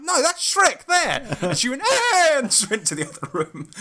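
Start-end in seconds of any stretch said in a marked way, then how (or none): 0.0-1.3 high, slow mock exclamation; teasing callout. Retelling teasing callout at film. 1.8-2.4 loud, very high imitated wail; mock child's shriek. Imitating niece's outburst before leaving.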